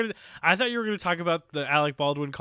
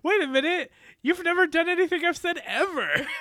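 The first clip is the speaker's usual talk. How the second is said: high-pitched, loud comic protest; indignant outburst; 4 dB louder than usual. Retold or imitated. Host voicing the coach objecting to Victor, mock indignant. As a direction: squawk it in mock outrage.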